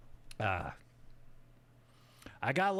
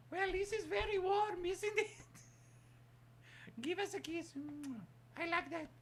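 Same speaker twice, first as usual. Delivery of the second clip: high squeaky affectionate voice; high cartoon voice, sweet; high puppet voice; 4 dB quieter than usual.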